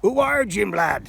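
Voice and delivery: bellowed pirate voice. Pretend. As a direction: shout it like a pirate captain. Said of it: "Can, can you do a pirate voice?".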